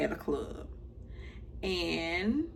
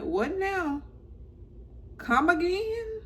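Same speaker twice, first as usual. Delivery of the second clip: high, incredulous "What now? Come again?"; mock disbelief; 12 semitones higher than usual. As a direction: act like you can't believe your ears. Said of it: "And I'm like".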